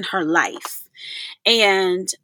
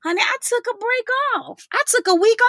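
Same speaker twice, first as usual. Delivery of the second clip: sassy honey; 11 semitones higher than usual. Real, not acted. Playful reply to listeners.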